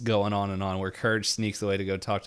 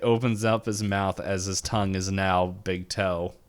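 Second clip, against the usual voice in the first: usual speaking voice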